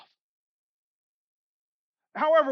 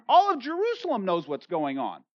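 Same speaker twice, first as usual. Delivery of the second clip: ordinary talk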